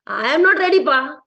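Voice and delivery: hammed-up Indian-English whine, "I am not ready, pa"; mock Indian accent. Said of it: "With the same accent".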